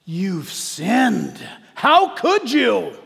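Satan turning on the believer in a loud accusation; accusing shout. Acted. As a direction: hurl the guilt at them. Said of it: "turns right around and says".

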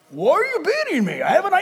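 high, hammed-up donkey protest; high-pitched mock hurt; aggrieved donkey. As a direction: plead indignantly as a comic talking donkey. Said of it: "and then the donkey goes".

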